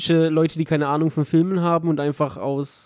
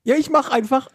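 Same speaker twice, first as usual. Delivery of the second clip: voice cracking; 7 semitones higher than usual. Real, not acted. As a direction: voice cracking and giving out. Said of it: "meine Stimme versagt".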